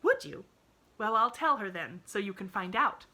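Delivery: put-on bright, high, cheerful child. A narrator's reading. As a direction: chirp it brightly. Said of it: "promised the little girl cheerfully".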